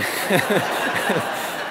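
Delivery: a quick voiceless laugh. Real, not acted. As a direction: laugh at the memory. Recalls villagers laughing at him.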